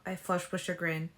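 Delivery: plain reading voice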